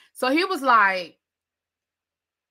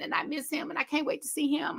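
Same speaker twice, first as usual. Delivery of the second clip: higher, softer, dreamy pining; lovesick sigh; 4 semitones higher, 10 dB quieter than usual. Retold or imitated. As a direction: pine for him softly. Mimicking a lovesick woman.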